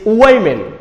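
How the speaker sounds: slow "women" with a full opening W; British pronunciation. Shown as a demonstration. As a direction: draw out "women", W clearly sounded. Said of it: "the pronunciation of British".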